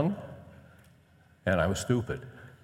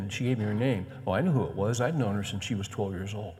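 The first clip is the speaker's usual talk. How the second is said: usual speaking voice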